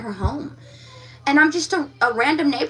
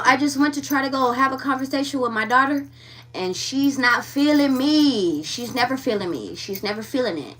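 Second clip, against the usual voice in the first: ordinary delivery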